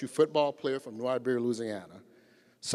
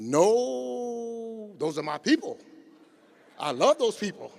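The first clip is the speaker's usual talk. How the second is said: higher and louder, a voice pleading for his people; mock pleading; 11 semitones higher, 7 dB louder, slower than usual. Skip protects the motorhome crowd, higher, louder pleading. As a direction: cry out that these are your people, higher and louder.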